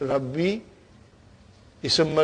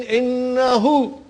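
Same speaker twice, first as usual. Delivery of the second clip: higher, drawn-out Innahu; recitation again; 7 semitones higher, 4 dB louder, slower than usual. Shown as a demonstration. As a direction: lift and stretch Innahu like a reciter.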